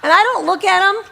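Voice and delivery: usual speaking voice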